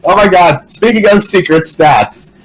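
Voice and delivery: normal talking voice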